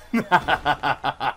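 low, steady ha-ha-ha chuckle; string out a gloating cackle; gloating laugh. Real, not acted. Gamer gloating over a slain enemy, rhythmic low laugh.

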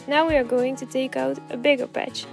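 ordinary talk

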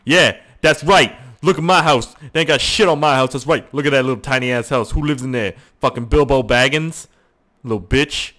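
mock gangster bragging at a neighbour; boastful taunt. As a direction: gloat over the tiny house. Bragging at the tiny neighbour house.

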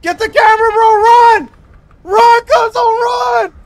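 loud high panicked yelling; panicking gamer. Real, not acted. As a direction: scream for help, trapped. Trapped in cage, panicking.

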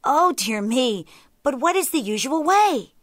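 loud bewilderment, rising in pitch; baffled jackal. A narrator's reading. Jackal feigns confusion to tiger.

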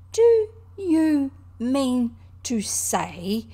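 loud, high, very slow and incredulous; drawn-out, agitated challenge; excited cry. A narrator's reading. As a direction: stretch out every word in disbelief. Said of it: "cried the excited Rat".

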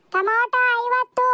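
high, slow, drawn-out price call; slow high-pitched vendor's call. Acted. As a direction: stretch it out high so she hears. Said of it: "ಅದಕ್ಕೆ ಕಿರ್ಚ್ಕೊಂಡು ಹೇಳ್ತಿರೋದು".

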